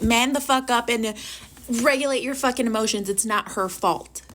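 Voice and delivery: ordinary talk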